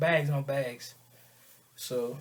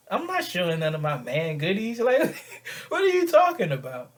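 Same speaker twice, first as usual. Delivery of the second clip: baffled protest rising into a high laugh; incredulous; 4 semitones higher, 5 dB louder than usual. Acted. Man answering her odd accusation, incredulous. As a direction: climb high and loud in disbelief.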